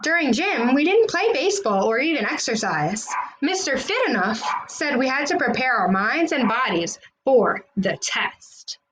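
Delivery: ordinary delivery, nothing marked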